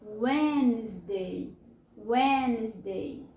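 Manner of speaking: "Wednesday" slowly as WENZ-day, no first D; silent D. Shown as a demonstration. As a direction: draw out "Wednesday" in two syllables, WENZ-day. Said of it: "ele é mudo".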